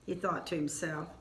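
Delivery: ordinary delivery, nothing marked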